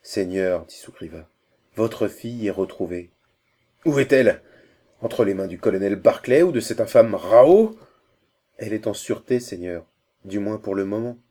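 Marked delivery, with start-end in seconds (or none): 3.8-4.4 loud, high, urgent question; anxious father's sudden cry; urgent outcry. A narrator's reading. Father learns daughter is found. 5.0-7.8 loud, alarmed questioning, swooping up at the end; urgent, indignant father; agitated question. A narrator's reading. Father fears who holds daughter.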